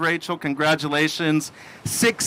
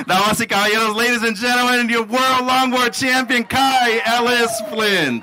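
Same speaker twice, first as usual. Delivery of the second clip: ring announcer; 6 semitones higher than usual. Acted. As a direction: stretch the champion's name like a ring announcer. Crowning the champion on stage.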